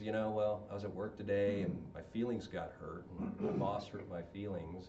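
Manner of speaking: low, soft, downcast confession; imitating a mildly tearful man; mock hurt guy. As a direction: mumble it like a wounded camper. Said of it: "one guy in the campfire starts crying".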